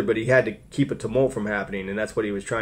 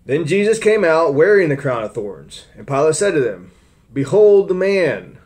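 raised, louder Bible reading voice; scripture reading; 5 semitones higher, 6 dB louder than usual. Acted. Preacher reading Pilate's trial to the church, raised voice. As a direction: read the Gospel out to the congregation.

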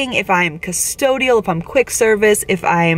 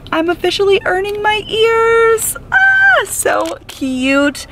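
thrilled squeal; 13 semitones higher, slower than usual. Real, not acted. New cast member showing her earning-my-ears badge, thrilled squeal. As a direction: burst with joy at the badge.

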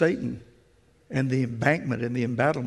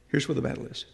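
soft echo; 7 dB quieter, faster than usual. Shown as a demonstration. Preacher repeats it hushed to the congregation. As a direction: repeat it softer, dropping away.

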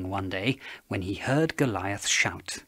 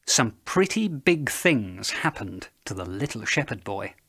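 ordinary delivery

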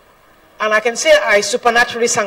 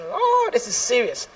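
worried plea; 12 semitones higher, 6 dB quieter, slower than usual. Retold or imitated. Anxious inner plea.

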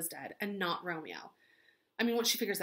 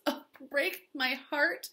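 higher, slow, hammed-up heartbreak; dramatic Juliet; 6 semitones higher, slower than usual. Juliet reacting to the nurse's news, hammed-up grief. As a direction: overplay Juliet's grief, drawn out. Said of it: "She goes".

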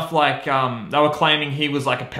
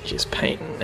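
whisper; 8 dB quieter than usual. Acted. Cut-in aside over music.

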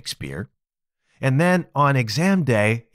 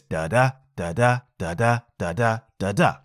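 short soft DA, then longer accented DA, five times over; low, even da-DA rhythm; soft-accented demo; 3 semitones lower than usual. Shown as a demonstration. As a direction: chant da-DA in five iambic pairs. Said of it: "Sounds like this".

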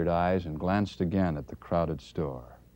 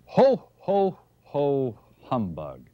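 sour santa laugh; 8 semitones higher, 7 dB louder, much slower than usual. Acted. Santa's weary ho ho ho.

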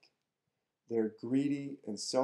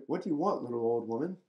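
surprised; faster than usual. Acted. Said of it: "the surprised Tsar asked".

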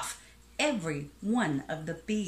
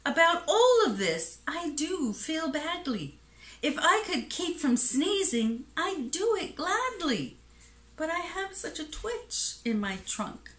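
high-pitched, pleading apology; sad elephant; 9 semitones higher than usual. A narrator's reading. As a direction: explain yourself with mild storybook regret. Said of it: "said the elephant sadly".

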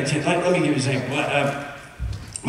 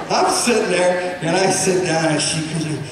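ordinary talk, nothing marked

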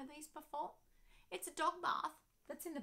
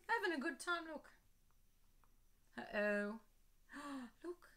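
ordinary delivery

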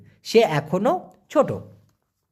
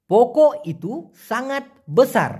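ordinary talk

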